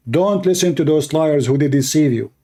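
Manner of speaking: ordinary talk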